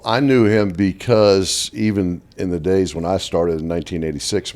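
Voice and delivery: ordinary talk, nothing marked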